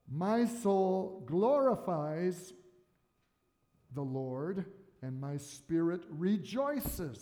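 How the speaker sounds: slow reverent reading, glorifies drawn out; solemn slow reading of My soul glorifies; scripture reading. Acted. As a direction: read it like a holy text. Reading Mary's song aloud.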